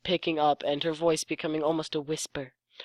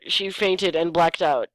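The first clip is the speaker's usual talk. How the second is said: ordinary delivery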